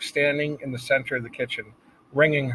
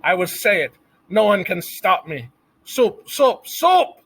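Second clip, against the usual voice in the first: defiant shout; 7 semitones higher, 5 dB louder than usual. A narrator's reading. Cook defiant, building to shriek.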